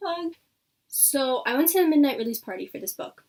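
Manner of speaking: normal talking voice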